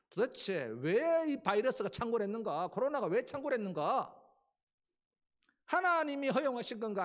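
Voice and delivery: building rhetorical questions; rhetorical challenge. Acted. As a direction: press the congregation with rising questions. Preacher raising the question.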